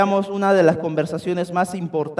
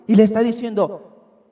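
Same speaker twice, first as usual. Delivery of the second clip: usual speaking voice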